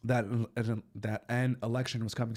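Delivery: normal talking voice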